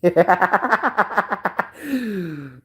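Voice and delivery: long rattling laugh; drawn-out giggle. Real, not acted. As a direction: laugh at your own silly voice. Laughs at his own voice.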